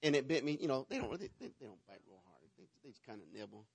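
ordinary delivery

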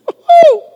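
high loud celebratory whoop; joyful whoop. Real, not acted. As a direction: let out a happy holler. Preacher celebrating.

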